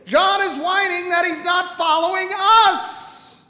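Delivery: loud, high, drawn-out bellow; preaching shout. Acted. Preacher declares who the sermon is for, shouting. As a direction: belt each phrase out slowly at full force.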